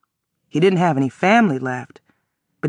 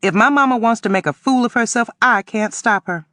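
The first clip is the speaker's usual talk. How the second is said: put-on raised, sharp teenage retort; sassy; 7 semitones higher than usual. A narrator's reading. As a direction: sass him back. Girl sasses old man.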